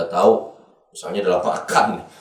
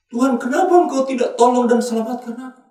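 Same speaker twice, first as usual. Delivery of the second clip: high-pitched acted lament; mock plea to God; 13 semitones higher, 4 dB louder than usual. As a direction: plead upward as if abandoned.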